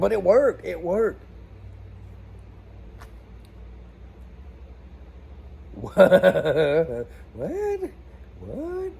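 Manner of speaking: can't believe it happened; stunned disbelief. Real, not acted. To viewers, amazed the hose came out: rising disbelieving what.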